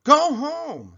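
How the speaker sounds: high, loud angry bully yell. A narrator's reading. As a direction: yell him out of the box. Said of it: "Sammy yelled even louder".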